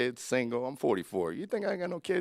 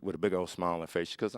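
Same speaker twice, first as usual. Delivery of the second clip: ordinary delivery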